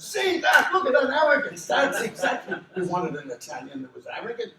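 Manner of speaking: high, shouted imitation of triumph; loud, excitable re-enactment of a director; delighted director. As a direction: shout it out as if proven right. Acts delighted director exclaiming.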